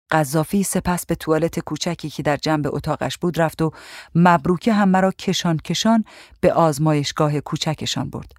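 ordinary delivery, nothing marked

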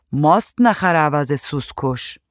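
ordinary delivery, nothing marked

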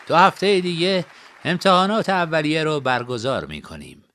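put-on old principal making a public announcement; principal announcing. A narrator's reading. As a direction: announce it to the whole hall. Principal addresses the crowd.

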